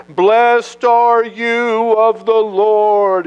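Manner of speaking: high, slow, fawning flattery; butter up the prophet. Acted. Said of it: "really trying to butter the prophet up".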